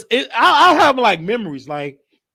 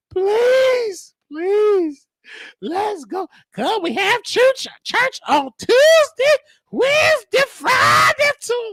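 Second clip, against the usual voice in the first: very high, shouted churchy plea; hammed-up grandma listing church days; high grandma pleading; slower than usual. Grandma begging about church.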